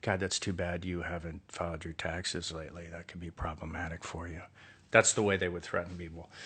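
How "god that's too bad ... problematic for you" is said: soft, sweetly polite threat, a touch of mock concern; kindly menace. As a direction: threaten gently while sounding nice. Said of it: "kill people by talking really kindly".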